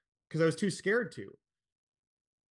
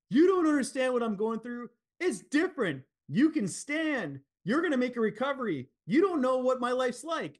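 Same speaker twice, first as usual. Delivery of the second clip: higher and louder, a bitter shout; retold angry outburst; 9 semitones higher, 5 dB louder than usual. Dad in wheelchair snapping at his son across the table, angry shout. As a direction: hurl it back at him, raising your voice high.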